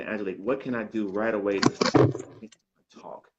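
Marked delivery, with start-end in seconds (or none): none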